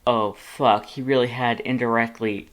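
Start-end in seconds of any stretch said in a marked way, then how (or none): none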